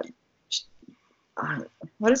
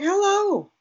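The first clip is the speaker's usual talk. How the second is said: high greeting to the cow; 13 semitones higher, 4 dB louder than usual. Retold or imitated. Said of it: "I'm like".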